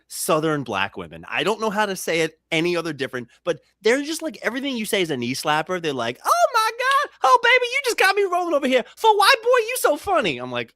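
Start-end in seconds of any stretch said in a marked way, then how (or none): 6.2-10.4 church lady voice. Retold or imitated. Church ladies voiced in story.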